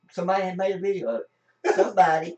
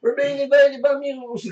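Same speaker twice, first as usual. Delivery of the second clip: high, loud mock panic; hammed-up alarm at the mules; 11 semitones higher, 7 dB louder than usual. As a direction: announce the invasion in jest. Joking alarm about mule story.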